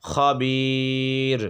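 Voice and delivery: খবির with বি stretched three alifs long, ending on a still র; long madd aridh. Shown as a demonstration. Teacher demonstrating the pause lengthening. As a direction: hold the বি of খবির long, then stop on র. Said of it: "তিন আলিফ টেনে পড়বে".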